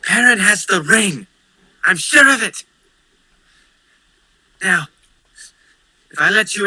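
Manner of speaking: lightly voiced schemer; scheming whisper. GM voicing scheming character.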